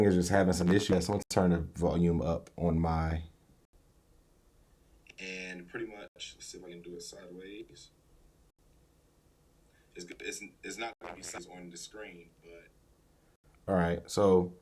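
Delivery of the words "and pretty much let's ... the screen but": faint tinny phone playback; distant recording from a phone speaker; played back through phone. Shown as a demonstration. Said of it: "Can't understand what you're saying".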